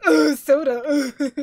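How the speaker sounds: loud, drawn-out groan of revulsion; hammed-up disgust, loud and slow; mock disgust. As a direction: act exaggeratedly repulsed. Acts out disgust at soda.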